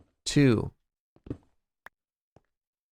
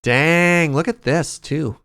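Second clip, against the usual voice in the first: surprised; 5 semitones higher, 5 dB louder, slower than usual. Real, not acted. Surprise at finding.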